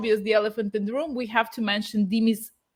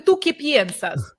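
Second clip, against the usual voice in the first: Russian accent; 4 semitones higher, 5 dB louder than usual. Shown as a demonstration. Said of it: "Of course I can do the Russian accent".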